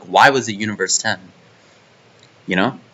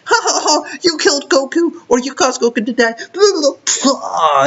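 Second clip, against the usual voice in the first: much higher, cackling mock taunt; mock Chi-Chi taunt; 13 semitones higher than usual. Fan mocking a Chi-Chi scene, high cackling taunt. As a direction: squeak out a gloating taunt. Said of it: "be like".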